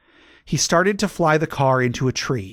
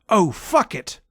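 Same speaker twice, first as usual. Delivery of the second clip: mild angry shout; 6 semitones higher than usual. A narrator's reading. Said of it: "Vampire shouted angrily".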